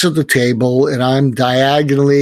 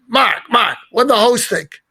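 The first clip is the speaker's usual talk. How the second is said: higher, put-on Brooklyn accent of an old talk-show host; old Brooklyn guy scolding a friend in a higher Brooklyn accent; Larry King Brooklyn voice; 9 semitones higher than usual. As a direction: do a raspy-free, high Brooklyn accent, teasing a friend. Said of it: "in his Brooklyn voice".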